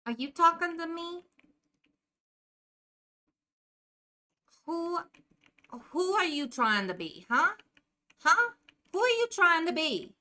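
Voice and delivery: put-on a lightly done Jersey accent. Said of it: "You didn't even know there was a Jersey accent".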